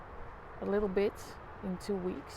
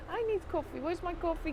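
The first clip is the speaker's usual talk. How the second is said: higher, whiny coffee plea; mock whiny plea; 7 semitones higher than usual. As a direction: whine for coffee like a child. Mock whine repeated higher.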